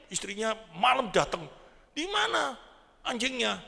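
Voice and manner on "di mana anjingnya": high, pressing question; sharp high demand from the wife. Acted. Wife coming home asking her husband where the dog is, high and insistent.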